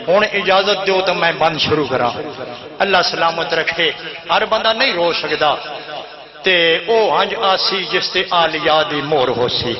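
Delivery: ordinary talk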